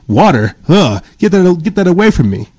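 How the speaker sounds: put-on squeamish refusal; mock disgust. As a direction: recoil in exaggerated revulsion at the offer. Acts out people refusing water.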